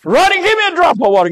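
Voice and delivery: high, fast desperate plea; pleading burned man. Retold or imitated. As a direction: beg for water, voice pitched high. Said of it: "And he began to say".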